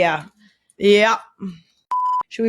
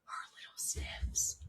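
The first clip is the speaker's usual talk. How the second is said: soft slow whisper right at the mic; hushed ASMR whisper over the bunny's sniffs; 20 dB quieter than usual. Real, not acted. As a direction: breathe it out like an ASMR video. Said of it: "Can you do A S M R little sniffs?".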